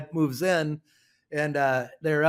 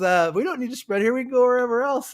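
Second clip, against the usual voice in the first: higher, louder, lively retelling; friendly offer; 10 semitones higher, 6 dB louder, faster than usual. Retold or imitated. Grandpa tells the new neighbor they'll spread elsewhere, higher and louder. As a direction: make the generous offer brightly.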